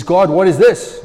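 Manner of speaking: slow, loud, pleading outcry; anguished cry to God. Retold or imitated. Sick man in hospital crying out to God, loud and slow. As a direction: demand an answer from God, slowly.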